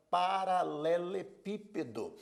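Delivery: slow, measured "paralelepípedo", every syllable separated. Shown as a demonstration. Teacher presenting an example word to students, syllable by syllable. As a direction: sound out "paralelepípedo" piece by piece.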